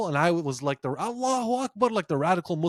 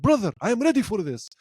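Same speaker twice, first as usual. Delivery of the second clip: raised, eager Khabib impression; imitating Khabib; 6 semitones higher than usual. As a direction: echo the fighter's calm readiness, pitched up. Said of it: "When he said".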